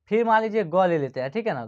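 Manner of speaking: usual speaking voice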